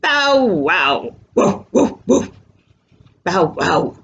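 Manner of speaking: dog barks, bow-wows and short woofs; Clifford. A narrator's reading. Storyteller voices the dog for waiting friends, playful barking. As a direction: bark like a big dog in words. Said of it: "Clifford says".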